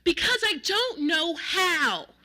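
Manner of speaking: slow, high, loud outburst; drawn-out, fed-up holler; exasperated yell. A narrator's reading. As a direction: yell it in helpless frustration. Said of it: "threw up his hands and yelled".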